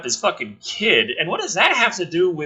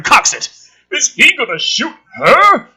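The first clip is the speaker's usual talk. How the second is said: usual speaking voice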